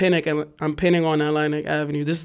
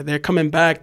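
usual speaking voice

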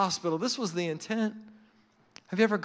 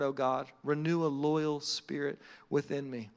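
low, softened scripture reading; prayerful psalm reading; 7 semitones lower than usual. Acted. Preacher reading a psalm to God, prayerful and low. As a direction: read it as a humble plea.

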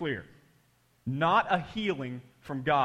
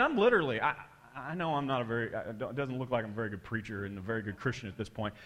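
usual speaking voice

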